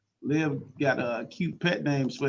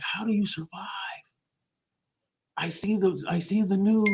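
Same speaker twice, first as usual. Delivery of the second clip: curious outsider; 4 semitones higher than usual. Retold or imitated. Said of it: "they get excited, like".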